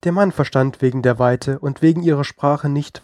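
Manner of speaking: plain reading voice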